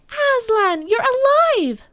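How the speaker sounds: put-on high, loud girlish cry of joy; joyful cry. A narrator's reading. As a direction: cry out high in overjoyed amazement. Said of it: "Lucy cried".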